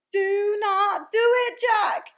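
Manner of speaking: high, slow, very loud plea; screeched. A narrator's reading. Giant begging Jack in his nightmare, desperate screech. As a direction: screech each word in terror. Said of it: "he screeched".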